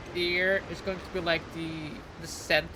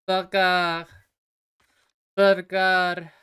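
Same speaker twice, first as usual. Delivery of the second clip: burger with a French r, bur-GER; French accent; 6 dB louder, much slower than usual. Shown as a demonstration. Streamer to chat, saying burger with a French accent. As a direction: say burger the French way, stressed on the end. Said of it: "I like saying burger in French".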